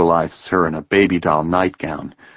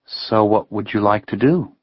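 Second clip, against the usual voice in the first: lightly voiced young woman; 3 semitones higher than usual. A narrator's reading. Heather asking Ben his plans, lightly teasing. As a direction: toss the question back playfully. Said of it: "her voice lowering".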